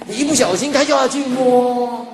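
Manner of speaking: ordinary talk, nothing marked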